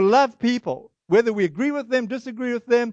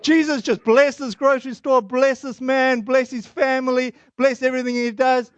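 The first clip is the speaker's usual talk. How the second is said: higher, rising over the other talker in prayer; acted-out prayer; 4 semitones higher than usual. Visitor praying aloud over a talking shop owner, insistent. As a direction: reenact an insistent blessing, phrase by phrase. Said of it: "I'll pray".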